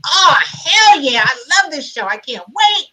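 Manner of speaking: big, gushing retold enthusiasm; thrilled fan. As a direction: relive being a thrilled fan. Retelling own thrill as fan.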